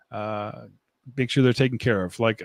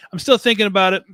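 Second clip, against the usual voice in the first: higher and louder, leaning on still; emphatic repeat; 8 semitones higher, 6 dB louder, faster than usual. Shown as a demonstration. To viewers about the two copies, an emphatic louder repeat. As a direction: really stress that he still hasn't decided.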